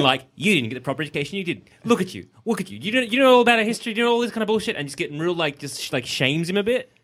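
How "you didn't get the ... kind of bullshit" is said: fast, scolding schoolteacher rant, rising toward the end; stern teacher. Retold or imitated. Krypton teacher scolding Superman for his education, sternly. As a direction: scold him like a harsh teacher. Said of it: "real stern".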